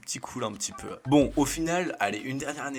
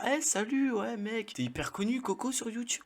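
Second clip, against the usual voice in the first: excited driver greeting; 10 semitones higher than usual. Retold or imitated. Bus driver greets him.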